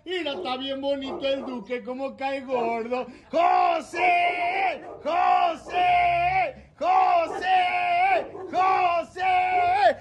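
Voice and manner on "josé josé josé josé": drawn-out, evenly repeated name chant; calling out a name. Real, not acted. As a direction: chant the name in long shouts. Birthday name chant before the song.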